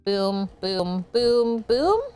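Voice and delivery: ordinary talk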